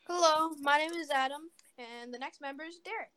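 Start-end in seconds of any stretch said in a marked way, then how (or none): 0.1-1.4 loud announcing hello; loud intro. Pretend. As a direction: belt out the introduction.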